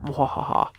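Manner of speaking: breathy evil cackle; a whispered villain laugh; mad scientist laugh. Pretend. Gloating over clue.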